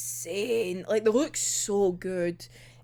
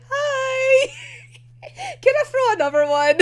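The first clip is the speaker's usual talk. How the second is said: put-on cheery kid voice; 14 semitones higher, 6 dB louder than usual. Voicing cheerful Kenji.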